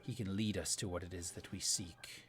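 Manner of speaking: low, hushed villain voice; soft, deep command with quiet menace; menacing commander. Acted. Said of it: "you hear this voice say".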